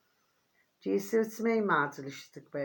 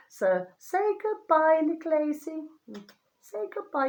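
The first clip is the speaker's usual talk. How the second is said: tender goodbye; 11 semitones higher, 5 dB louder than usual. Real, not acted. A woman saying goodbye to her beloved plant, high and tender. Tearful farewell to a dead plant.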